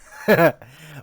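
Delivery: a loud, high burst of laughter. Acted. Leader laughing off the question about the Ripper, loud and high.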